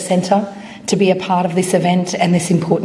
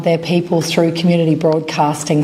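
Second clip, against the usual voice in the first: normal talking voice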